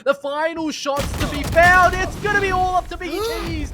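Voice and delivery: building to a screaming clutch call; esports caster. Acted. Clutch moment caster peak.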